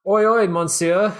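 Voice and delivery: loud, high, bad French waiter accent. A narrator's reading. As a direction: call out in a hammed-up French accent. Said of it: "he said in a French accent".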